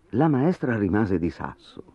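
usual speaking voice

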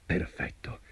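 hushed, breath-only satisfaction; whispered to himself. A narrator's reading. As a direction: whisper it privately. Tailor murmurs to himself, satisfied.

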